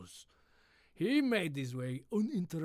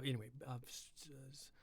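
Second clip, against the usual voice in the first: usual speaking voice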